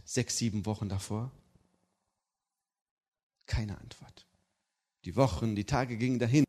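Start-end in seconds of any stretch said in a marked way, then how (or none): 3.5-4.3 quiet, falling. Shown as a demonstration. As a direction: drop it quietly. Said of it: "repeated phrase, first take quiet".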